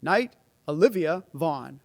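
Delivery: slow, formal name call; knighting ceremony. Real, not acted.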